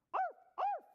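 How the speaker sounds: loud, high puppy yaps; dog bark. Acted. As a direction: bark sharply like an excited puppy. Puppy finally barks.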